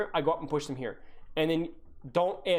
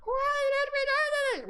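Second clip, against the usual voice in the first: very high, slow squeak of a voice; squeaky prepubescent voice; slower than usual. Shown as a demonstration. Coach's imagined voice in the player's ear, squeaky and slow. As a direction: squeak it like a kid whose voice hasn't broken. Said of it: "silent, squeaky, prepubescent voice".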